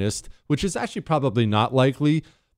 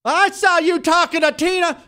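shrill-high mock outburst, much higher pitch; loud angry girlfriend; 4 dB louder than usual. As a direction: play a girlfriend making a loud public scene. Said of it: "she starts to get loud".

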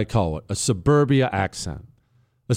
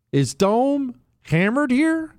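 higher, slow sing-song; mock suburban earnestness; 11 semitones higher, slower than usual. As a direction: ham up the earnest tone. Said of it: "speaking with a suburbia accent".

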